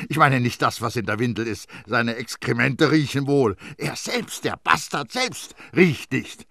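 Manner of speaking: irritated correction; gruff. A narrator's reading. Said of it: "sagte die Amme unwirsch".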